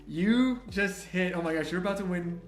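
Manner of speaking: ordinary talk, nothing marked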